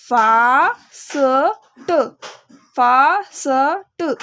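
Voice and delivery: teacher stretches fast slowly for beginners; slow stretched blending. Shown as a demonstration. Beginner teacher blending the word very slowly. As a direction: drag fast out very slowly, sound by sound. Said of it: "तो क्या बना?".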